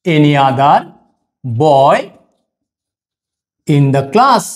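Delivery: very slow with long pauses; slow dictation. Shown as a demonstration. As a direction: dictate each word for copying. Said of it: "তারপরে হবে কি?". (থেমে থেমে বলা)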